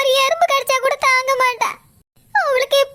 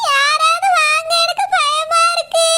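slow, higher, tearful plea for help; frightened crying; 4 semitones higher, slower than usual. Acted. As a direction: beg someone to come, scared. Labouring woman frightened, crying.

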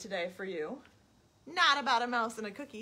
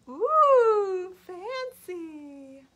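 admiring pig; 7 semitones higher, 8 dB louder, much slower than usual. Acted. The pig admiring her fancy boots, high voice. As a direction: swoon over the boots.

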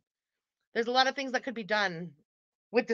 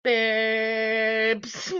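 one long, held monotone "babes"; lower, unwavering drawn-out monitor tone; mock flatline beep; 4 semitones lower than usual. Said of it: "Imagine dying and instead of beeps".

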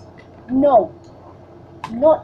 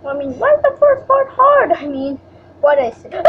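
ordinary talk

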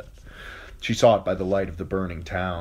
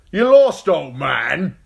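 loud, high jeering spearman; mocking; 10 semitones higher, 12 dB louder, slower than usual. A narrator's reading. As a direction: jeer down at the old man. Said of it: "mocked one of the spearmen".

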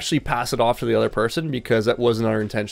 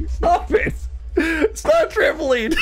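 laughing; 3 dB louder, slower than usual. Real, not acted. Cracking up mid-story.

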